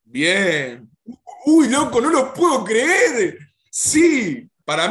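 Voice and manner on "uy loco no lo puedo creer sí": much higher and louder, thrilled gasp of disbelief; can't believe the good news, burst out; excited disbelief. Real, not acted. To his co-hosts about the reprint, thrilled disbelief.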